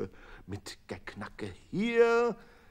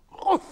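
shove noise; 11 dB louder than usual. A narrator's reading. Dough shoved into oven.

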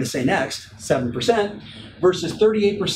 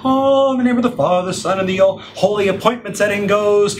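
loud, hammed-up church intonation; mock priest blessing; 3 semitones higher, 5 dB louder than usual. Coach joking about his prospecting shrine, mock priestly chant. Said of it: "it's like a ceremony".

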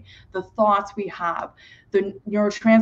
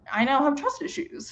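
mock hurt complaint; 7 semitones higher than usual. Nervous system voiced as hurt partner.

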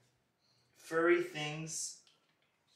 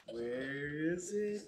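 slow suspense; much slower than usual. Pretend. Dad searching in suspense.

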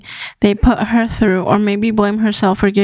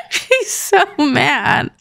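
slow, laughing through the words; 4 semitones higher, much slower than usual. Real, not acted. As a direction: chuckle while saying it, slow. Laughing at angry Sim.

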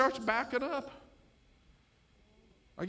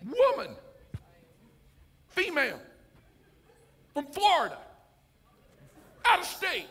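higher, snide territorial rant; mock indignant local; 8 semitones higher, 10 dB louder than usual. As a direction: ham up the grumpy local. Mocking the other driver.

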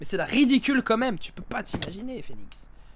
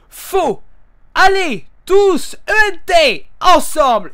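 mock lecturing; 10 semitones higher, 16 dB louder, slower than usual. Spelling out the word mockingly.

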